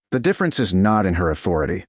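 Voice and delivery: plain reading voice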